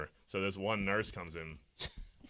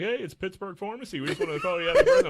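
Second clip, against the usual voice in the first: cheery pharmacy caller; 11 semitones higher, 11 dB louder than usual. Retold or imitated. Pharmacy caller wishing happy birthday.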